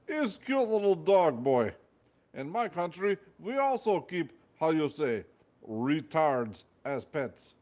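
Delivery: put-on a light Russian accent, slow and amused; lightly voiced Russian guard. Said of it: "He had a thick Russian accent".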